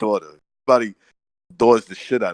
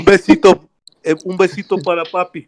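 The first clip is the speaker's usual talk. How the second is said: put-on higher, louder Mexican accent begging for a kiss; mock Mexican accent; 7 semitones higher, 4 dB louder than usual. Mocking a fighter asking his daughter for a kiss, in a put-on Mexican accent. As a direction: tease in a hammed-up Mexican accent. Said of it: "What are you doing the Mexican accent?".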